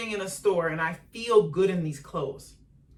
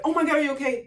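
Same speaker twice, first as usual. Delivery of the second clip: much higher, louder panicked parent; imitating alarmed parent; 9 semitones higher, 6 dB louder, much faster than usual. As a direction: gasp in alarm over the kid. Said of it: "the parent's like".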